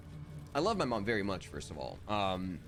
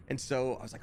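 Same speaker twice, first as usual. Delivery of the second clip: ordinary delivery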